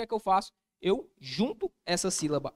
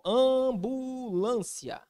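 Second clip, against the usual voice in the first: AMBULÂNCIA stretched long, high and sustained; drawn-out word; 6 semitones higher, 3 dB louder, much slower than usual. Shown as a demonstration. Teacher giving another example, ambulância very slowly drawn out. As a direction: draw out "ambulância" syllable by syllable, pitched high.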